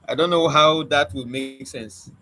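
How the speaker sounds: normal talking voice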